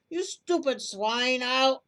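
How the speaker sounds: enraged robber building to a shout; slow, furious insult building to a yell. Acted. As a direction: spit out the insult slowly, then blow up. Robber insults another, cut off.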